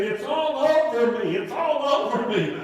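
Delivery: gushing with wonder, half laughing; excited. Retold or imitated. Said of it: "he said with an excited voice".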